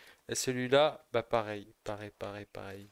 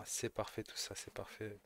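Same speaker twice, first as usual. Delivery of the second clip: whispering; 10 dB quieter than usual. Real, not acted. Pleased over found ammo.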